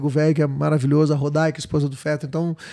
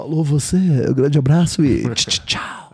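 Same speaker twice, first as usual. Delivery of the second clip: lower-pitched hammy radio host sign-off; Rodson dos Anjos; 4 semitones lower than usual. Acted. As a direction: sign off on air, sputtering the goodbye. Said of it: "que é um radialista". (radialista caricato se despedindo)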